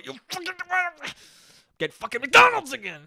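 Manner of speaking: high-pitched, swelling to a loud half-laughing yell; angry self-scolding outburst. Shown as a demonstration. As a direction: explode at yourself once nobody can see. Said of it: "when I get around the corner ... I go".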